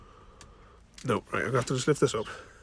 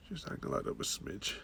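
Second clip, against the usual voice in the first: soft whispered instruction; hushed aside to the camera; 6 semitones lower, 10 dB quieter than usual. Real, not acted. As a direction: whisper it so the cat isn't startled. Quiet aside while filming.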